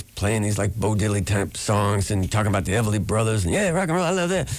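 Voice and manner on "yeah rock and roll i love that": much higher, playful mock young folk singer. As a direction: spin a tall tale in a high put-on voice. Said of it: "He says".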